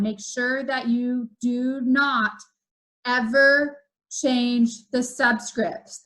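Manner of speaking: measured, deliberate warning; slow right down to hammer the rule home; weighty words with pauses between them; slow, emphatic. Shown as a demonstration. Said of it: "say that one more time".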